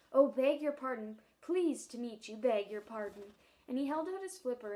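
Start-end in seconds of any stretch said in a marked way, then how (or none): 0.1-3.3 louder, hastily courteous seal; smooth things over politely; polite apology. Acted. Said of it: "said politely enough".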